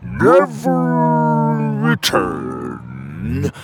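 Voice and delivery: very slow, rumbling giant voice. Acted. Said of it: "rumbled one of the cave giants".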